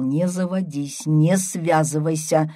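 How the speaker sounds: usual speaking voice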